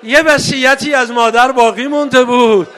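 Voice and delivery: 3 wail the tale to the mourners; 4 full-voiced rawzeh lament; 1 lamenting sermon.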